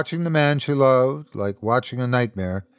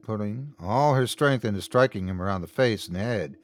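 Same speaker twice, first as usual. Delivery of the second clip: usual speaking voice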